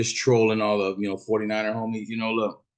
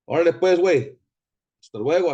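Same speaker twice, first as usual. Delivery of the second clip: louder, higher Mexican slang send-off; loud mock slang farewell; 7 semitones higher, 7 dB louder, faster than usual. To the angry fan, a loud teasing Mexican slang goodbye. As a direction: shout it out like a homie.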